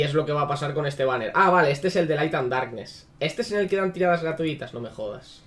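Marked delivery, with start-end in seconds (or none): 4.7-5.4 low, very soft disbelief; muttered disbelief. Real, not acted. Streamer learning where free pulls are, muttered disbelief. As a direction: say it under your breath, appalled.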